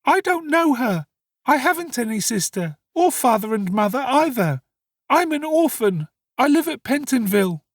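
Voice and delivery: put-on high-pitched frightened boy; alarmed protest. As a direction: deny it all in a panicked high voice. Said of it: "greatly alarmed".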